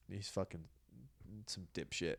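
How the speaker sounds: dropped to a mutter; muttered aside. Real, not acted. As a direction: mutter the insult under your breath.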